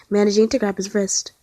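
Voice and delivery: ordinary delivery, nothing marked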